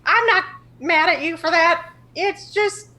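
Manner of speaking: put-on high, loud, laid-back old trickster voice; Tishvia impression. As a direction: play him high and loud, shrugging it off. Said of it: "If I know Tishvia, he's gonna say".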